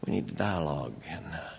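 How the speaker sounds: mock the meek conversational style, voice dropped low; mock soft suggestive. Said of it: "we should be suggestive, not declarative".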